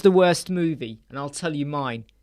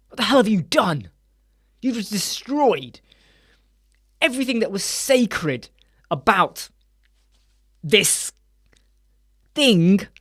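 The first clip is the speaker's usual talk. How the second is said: outraged fan; 3 semitones higher than usual. Retold or imitated. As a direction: spell out the betrayal word by word. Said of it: "And you're like".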